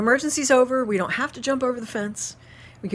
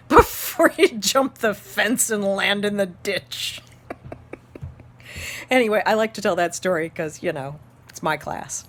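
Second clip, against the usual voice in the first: usual speaking voice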